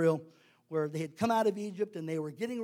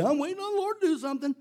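higher, quick mocking voice; imitating an idle believer, pitched up; mock lazy excuse; 6 semitones higher, much faster than usual. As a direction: rattle it off as a flimsy excuse.

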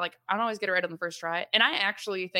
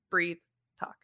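usual speaking voice

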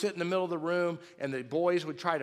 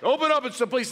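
loud, raised mock cop voice; Lisa; 10 semitones higher, 9 dB louder, faster than usual. Wife playing cop at the door, loud mock man voice. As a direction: imitate a woman playing a tough policeman. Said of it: "in this real deep man voice".

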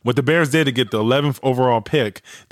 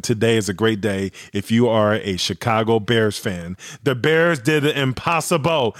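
normal talking voice

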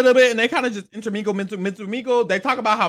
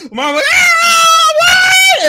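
very high, loud, shrieking welcome; hammed-up squealing reunion cry; excited scream; 9 dB louder, slower than usual. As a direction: scream her name in delighted shock.

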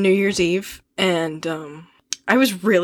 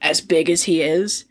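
ordinary talk, nothing marked

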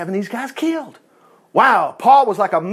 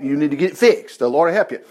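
ordinary talk

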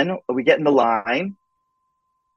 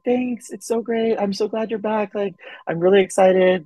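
higher, bright gushing voice of Kelly; [name] on the phone thanking him, higher; bubbly Kelly; 7 semitones higher than usual. Retold or imitated.

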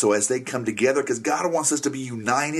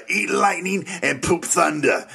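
quoting the trainer line; 6 semitones higher, slower than usual. Retold or imitated. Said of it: "we kinda say it like this".

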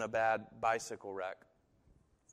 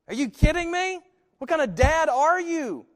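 mock child screaming, far higher and louder; hammed-up child yelling at his dad; child's scream; 14 dB louder than usual. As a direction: shriek like a terrified betrayed kid.

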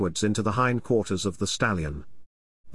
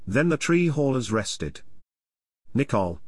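ordinary delivery

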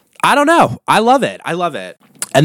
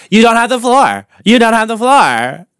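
shady reality-show host, pitched up; dialed-up shade; 7 semitones higher than usual. Retold or imitated. Host telling off Kristen for talking over somebody, shady. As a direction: imitate a host scolding someone with shade. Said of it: "a dialed up shade to it".

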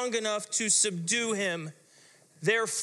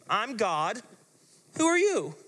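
teasing; 3 semitones higher than usual. Acted. Jesus teasing the demon.

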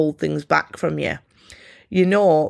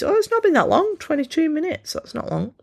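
surprised; 12 semitones higher than usual. Real, not acted. Surprised at the episode length.

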